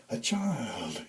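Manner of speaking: a soft breathed hush. A narrator's reading. Santa leaning in close to Emily, soft breathed. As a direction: breathe the words out like cold air. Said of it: "he breathed".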